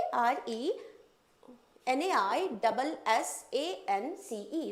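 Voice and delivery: slow letter-by-letter spelling; spelled out. Shown as a demonstration. Teacher dictating the spelling slowly. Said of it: "spelling renaissance letter by letter".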